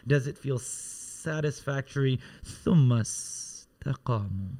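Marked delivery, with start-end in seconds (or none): 0.6-2.2 "satisfactory" with a long hissed first S; drawn-out S. Shown as a demonstration. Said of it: "Say satisfied". 2.6-4.5 ثم استقاموا with the S held long. Shown as a demonstration. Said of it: "Say satisfied".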